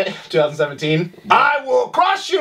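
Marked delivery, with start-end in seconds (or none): 1.3-2.4 slow booming barbarian war cry, high. Pretend. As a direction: roar it like a conquering warrior. Mock taunt during rider banter.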